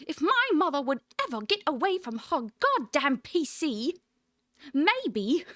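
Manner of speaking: put-on sassy, posh old British lady voice. Shown as a demonstration. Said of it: "it needs to be a little bit more posh".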